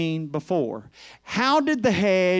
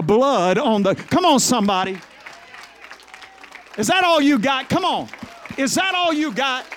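rousing call-out; 4 semitones higher than usual. Real, not acted. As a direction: push the congregation to answer back. Preacher calling on the crowd.

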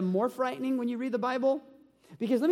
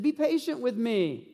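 begging servant; 6 semitones higher than usual. Acted. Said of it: "he begged".

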